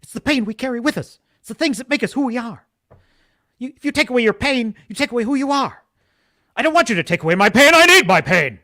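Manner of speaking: mock Shatner speech rising to a loud plea; Captain Kirk impression. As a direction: ham up a pleading starship captain. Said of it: "as Captain Kirk once explained to Sybok".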